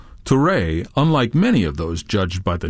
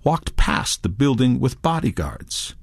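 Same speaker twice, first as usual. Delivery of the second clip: usual speaking voice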